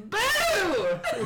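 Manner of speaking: high, drawn-out mock-spooky cry; playful scare. Player bursts from a stall to scare him, high playful boo. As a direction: leap out at them with your voice. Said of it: "what do you say as you come out".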